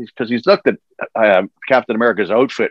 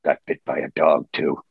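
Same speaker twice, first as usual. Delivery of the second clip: Wolverine deadpan; 8 semitones lower, 5 dB quieter than usual. Retold or imitated. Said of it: "he says".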